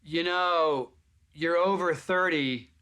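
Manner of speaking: woman at the back dismissing the actor, hammed-up; mock snooty woman. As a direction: drawl out the insult from the back. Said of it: "I hear a woman go".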